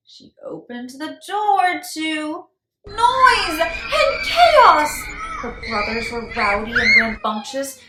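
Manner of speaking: loud lively narration; loud chaos. A narrator's reading. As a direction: build the chaos up. Chaos at home third time.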